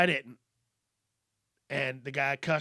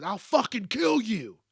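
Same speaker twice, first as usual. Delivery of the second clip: louder and higher mock threat; retold threat; 5 semitones higher, 5 dB louder than usual. Stranger threatening the driver through the window, retold angry yell. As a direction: bark it like a road-rage driver. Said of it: "the guy goes".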